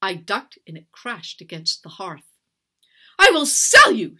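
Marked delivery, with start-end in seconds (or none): 3.2-4.2 high, very loud furious yell; shrieking, full-force outburst; screamed threat. A narrator's reading. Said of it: "she screamed".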